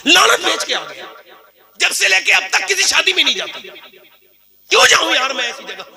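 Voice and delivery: high indignant declaration; preacher vows never to attend weddings; fiery preaching. Real, not acted. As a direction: swear off weddings with disgust. Preaching at full force.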